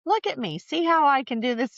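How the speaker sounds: high, playful imitation of a proud mother; proud mom. Daughter imitates her mother boasting she can still manage, high and proud. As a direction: show off like a mother proud of herself.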